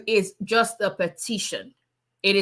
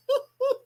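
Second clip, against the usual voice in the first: high, breathy little laugh; high giggle. Real, not acted. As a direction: giggle at her own joke. Laughs at her own joke.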